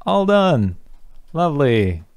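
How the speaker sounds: happy high "all done"; cheerful finish. Real, not acted. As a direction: announce you're done with real glee. Finishing the feature.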